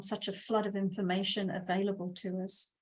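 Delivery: usual speaking voice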